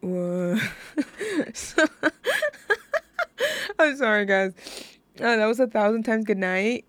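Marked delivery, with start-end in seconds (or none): 0.7-1.5 short giggle; laughing. Real, not acted. Host breaks up after song. 1.9-3.7 high, long giggling fit; can't stop laughing. Real, not acted. Host keeps laughing, apologizes.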